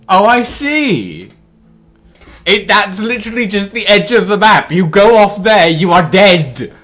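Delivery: loud excited outbursts, high. Real, not acted. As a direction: shout with sudden discovery. Reacts to map edge discovery.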